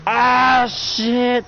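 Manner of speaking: high, loud cursing yelp; loud dismayed cry. Acted. Fighter caught off guard, loud dismayed curse. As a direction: yell it in alarm.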